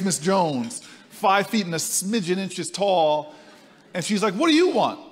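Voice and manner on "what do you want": high, loud mock gruff old woman; gruff old lady. Miss Jones challenges the young visitor, high and loud. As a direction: bark it like a suspicious tenant at the door.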